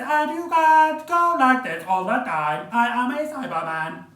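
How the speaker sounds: high, loud, sing-song robotic voice; Cyberman voice. Retold or imitated. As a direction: chant it like a Tenth Planet Cyberman. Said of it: "sing-song-like voices".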